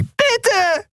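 very high, tiny plea; squeaking creature. A narrator's reading. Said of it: "piepste die Yadi".

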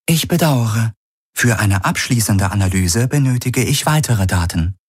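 high, neutral onboard computer reading out measurements; ship computer. Acted. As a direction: report data like a calm machine. Ship's computer answers.

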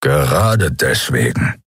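low, slow growl. A narrator's reading. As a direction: growl it out slowly from deep down. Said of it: "knurrte Keru".

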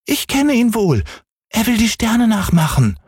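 sehr hohe Kinderstimme; kleiner Häwelmann. A narrator's reading. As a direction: ein Junge erklärt eifrig. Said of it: "sagte Häwelmann". (hohe, eifrige Kinderstimme)

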